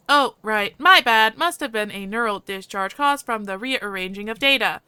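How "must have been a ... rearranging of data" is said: normal talking voice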